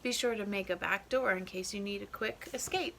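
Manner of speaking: usual speaking voice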